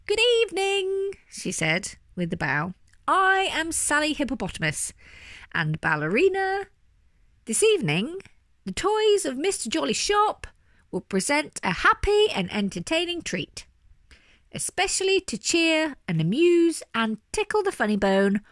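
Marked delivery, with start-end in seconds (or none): 0.1-1.1 high, ringing stage greeting; grand introduction. A narrator's reading. Hippo ballerina greeting her audience, grand high announcement. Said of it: "the hippopotamus boomed loudly". 3.1-18.4 high, ringing show announcement; grand introduction. A narrator's reading. Hippo ballerina opening a show for a sad bear, grand high announcement. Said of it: "Sally was the first to introduce herself".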